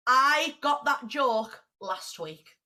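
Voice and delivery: ordinary talk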